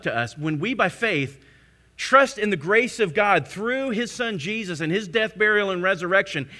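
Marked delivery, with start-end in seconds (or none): none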